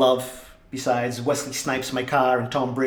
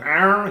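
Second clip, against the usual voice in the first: imitating an angry cat's grunt; a short, higher, louder growl like the annoyed cat; mock cat growl; 6 semitones higher, 4 dB louder than usual. Copying the cat's grunt after the squirt bottle, mock growl. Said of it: "He goes".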